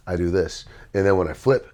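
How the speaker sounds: normal talking voice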